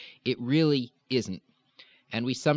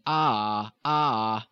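lilting vowel demo. Shown as a demonstration. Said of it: "inner vowel lilt".

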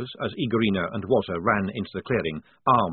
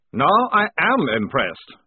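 slow, higher, louder praise; mock admiration; 7 semitones higher, 7 dB louder, slower than usual. A narrator's reading. Prisoner flattering the lieutenant, drawn out. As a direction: lay on the admiration deliberately.

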